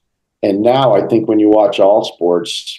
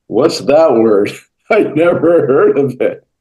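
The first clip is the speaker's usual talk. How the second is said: amused player; 4 semitones higher, slower than usual. Retold or imitated. Player mocks a fancy word.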